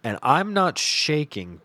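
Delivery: normal talking voice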